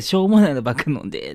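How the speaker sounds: loud hammed-up auntie telling-off; scolding Kansai auntie. Comic redoing the auntie's jibe for a partner, loud mock scolding. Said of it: "爆発しがちなおばちゃん集団".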